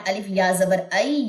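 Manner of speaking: ordinary talk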